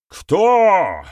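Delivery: high, loud barked question; furious bark. A narrator's reading. Clan leader barks at the silent accused, furious bark. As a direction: bark it out in barely held rage. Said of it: "вновь рявкнул этот вопрошающий".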